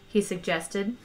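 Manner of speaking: plain reading voice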